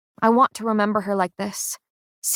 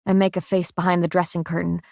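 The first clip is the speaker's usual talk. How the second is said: plain reading voice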